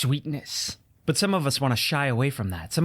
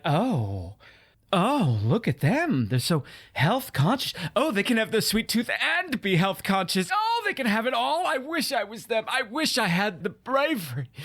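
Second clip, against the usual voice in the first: mock-awed diners at the next table, climbing higher; envious onlookers; 6 semitones higher than usual. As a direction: gush with exaggerated envy, pitch rising sharply. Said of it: "folks at table over are gonna be like".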